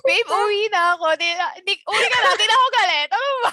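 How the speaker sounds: loud, high flustered squealing; giddy, swooning fan reaction; excited anime fan. Pretend.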